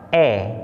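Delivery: "e" as open eh after y; open-mouthed "eh" for "e"; open e sound. Shown as a demonstration. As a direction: say "e" as a clear, held "eh". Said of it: "Apabila jatuh setelah huruf Y".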